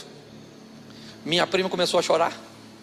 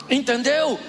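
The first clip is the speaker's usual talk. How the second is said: usual speaking voice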